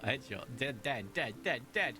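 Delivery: ordinary talk, nothing marked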